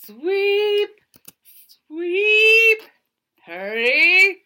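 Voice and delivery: high, loud sweeping calls; curling skip yelling. Acted. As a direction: bellow it down the ice.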